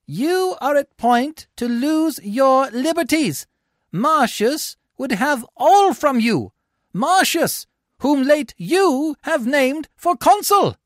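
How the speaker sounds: high, slow, loud oratory; rabble-rousing harangue. A narrator's reading. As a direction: stir up a crowd against him. Sicinius harangues the crowd.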